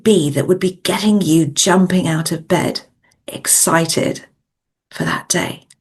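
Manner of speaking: measured coaching meditation voice with long pauses; guided visualisation. Acted. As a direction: guide them gently inward, phrase by phrase, leaving space to breathe.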